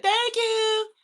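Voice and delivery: high, sing-song thank you of his mom; high church-lady thank you; mom's high thank you. Retold or imitated. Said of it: "My mom be like".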